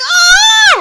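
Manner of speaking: high, loud labor scream; pained scream. Retold or imitated. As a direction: scream out in pain.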